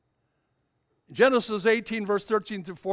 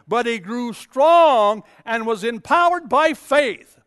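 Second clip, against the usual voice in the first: slow, building to loud; emphatic scripture reading; 5 semitones higher, 7 dB louder, slower than usual. Acted. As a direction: proclaim each phrase slowly, louder to the end. Preacher reads scripture with force.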